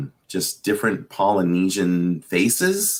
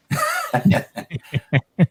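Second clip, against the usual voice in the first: high laugh. Real, not acted. Laughs at own joke.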